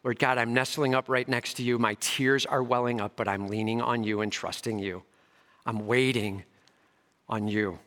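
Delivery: soft, low, tender prayer; trusting prayer. Pretend. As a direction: nestle in and whisper your trust. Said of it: "May it not be that".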